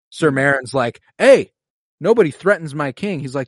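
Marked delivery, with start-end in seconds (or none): 1.2-3.2 loud, high challenge, acted out; retold angry warning. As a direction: jump in with a raised-voice warning. Meryn's acted warning in a quarrel.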